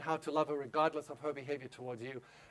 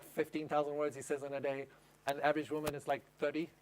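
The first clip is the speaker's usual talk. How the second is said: usual speaking voice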